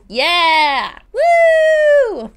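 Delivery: very high, loud whooping cheer. Acted. Friend cheering the diner plan with a loud whoop.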